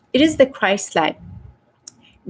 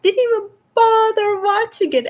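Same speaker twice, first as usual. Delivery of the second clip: much higher, "bother" peaking then falling; exasperated repeat; 11 semitones higher than usual. Real, not acted. Host to viewers, on light workers who skipped it, exasperated and much higher. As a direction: throw it up high in disbelief.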